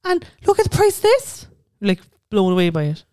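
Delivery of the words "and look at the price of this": high, wide-eyed gasp at prices; amazed tourist. Retold or imitated. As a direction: squeal it like a stunned tourist. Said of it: "you're like".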